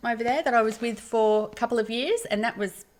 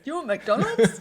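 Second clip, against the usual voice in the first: imitating dad; 5 semitones higher, slower than usual. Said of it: "And my dad goes".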